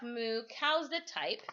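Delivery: plain reading voice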